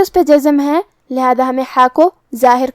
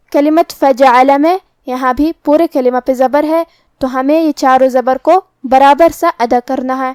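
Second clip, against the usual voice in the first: normal talking voice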